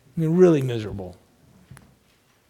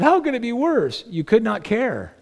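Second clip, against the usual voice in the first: incredulous caller; 7 semitones higher than usual. Retold or imitated.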